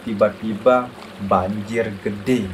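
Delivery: plain reading voice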